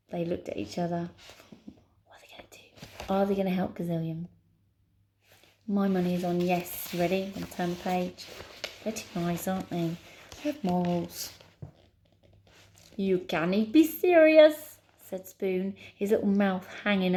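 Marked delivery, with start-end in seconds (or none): 2.1-3.1 hushed whisper to the listeners; suspenseful whisper. Real, not acted. 13.0-14.8 loud, high Scottish disbelief; Scottish accent. Acted. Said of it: "a Scottish accent".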